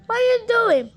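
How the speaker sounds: high, loud alarmed cry; gasped. Acted. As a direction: panic as the attack starts. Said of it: "Bilby gasped".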